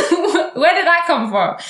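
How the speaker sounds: high, loud, slow laughing; laughing disbelief. Real, not acted. Telling viewers the absurd insult, laughing.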